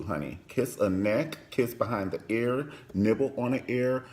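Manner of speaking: ordinary talk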